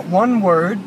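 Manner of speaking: usual speaking voice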